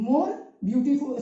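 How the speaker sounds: ordinary talk, nothing marked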